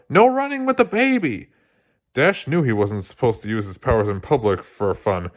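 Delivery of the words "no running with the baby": high scolding call; mother's high scolding yell. A narrator's reading. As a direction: shout after a running kid. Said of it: "Helen yelled".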